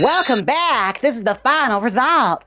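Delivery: big Southern-tinged flapper voice, high and loud; accidental Southern. Pretend. Host presenting the finished flapper look, accidentally Southern. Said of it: "sounded s-, like, Southern".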